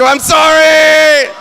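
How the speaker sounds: slow, high, loud apology; a shouted sorry, dragged out slowly; strained shouted apology. Retold or imitated. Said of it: "Just trying to wish him".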